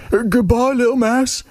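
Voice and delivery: high, flustered goodbye; frightened fox making an exit; nervous fox farewell. Acted. Fox flees from the Gruffalo, high nervous voice.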